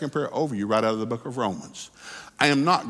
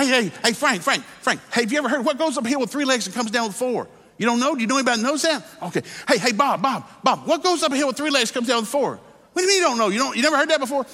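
frantic phone calls; 9 semitones higher than usual. Acted. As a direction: pester every friend for the answer, desperate. Businessman frantically phoning friends for answer.

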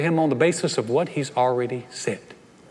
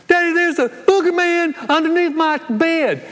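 put-on much higher child's voice, whiny; scared toddler; 14 semitones higher, 5 dB louder than usual. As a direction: play a frightened little kid calling daddy. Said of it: "little two-year-old coming to you and going".

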